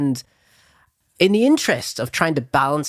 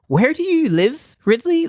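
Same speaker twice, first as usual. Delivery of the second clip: mock eager interviewer; 12 semitones higher, 3 dB louder than usual. Mimicking interviewer asking Ridley Scott.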